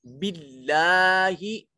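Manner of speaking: बिल्लाही with a thin lam and a long final ही; drawn-out बिल्लाही, light lam, held vowel; recitation demo. Shown as a demonstration. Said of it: "लाम को बरीक पढ़ा जाएगा ... एक अलिफ के बराबर लंबा".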